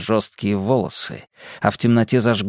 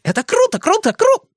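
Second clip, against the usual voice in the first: very high, repetitive cheering; excited exclamation. A narrator's reading. Joker cheers his friend's quip, exclaiming wildly. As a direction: cheer on until cut off mid-word. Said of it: "воскликнул Ричи".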